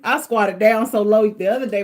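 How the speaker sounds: usual speaking voice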